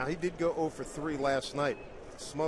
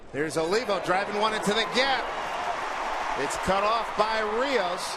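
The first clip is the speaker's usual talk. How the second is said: raised pitch, lifted live baseball call; excited play call; 6 semitones higher, 4 dB louder than usual. Real, not acted. Announcer calling Olivo's drive into the gap, excited. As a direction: call the hit as it flies into the gap.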